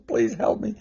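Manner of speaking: high, softened mock plea; hammed-up plaintive appeal; acted begging. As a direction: beg in a high, helpless voice. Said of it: "He would beg God".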